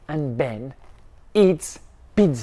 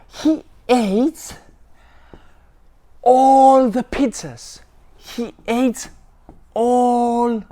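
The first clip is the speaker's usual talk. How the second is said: higher and louder, drawing out all; building excitement; 6 semitones higher, 4 dB louder than usual. Acted. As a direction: build to a gleeful climax. Excited pizza eating climax.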